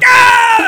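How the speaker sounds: high, loud scream of fright; panicked scream. Retold or imitated. Reliving the moment he saw the spiders, screaming. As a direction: shriek in horror. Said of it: "So I'm like".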